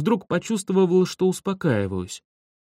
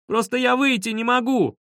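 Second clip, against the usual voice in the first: high, pleading cry; despairing high-pitched protest; desperate wail; 9 semitones higher than usual. A narrator's reading. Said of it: "в отчаянии завопил я".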